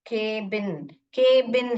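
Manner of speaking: के बिन slowly, with long "ay"; open-syllable reading. Shown as a demonstration. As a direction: draw out के, then add बिन. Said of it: "ये open syllable है".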